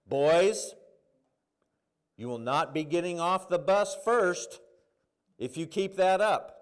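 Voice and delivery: put-on loud, firm announcement to unruly kids; bus driver on mic. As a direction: scold the boys over the bus microphone. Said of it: "she gets on the, the mic".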